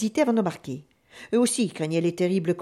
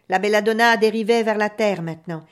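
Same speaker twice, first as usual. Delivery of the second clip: ordinary delivery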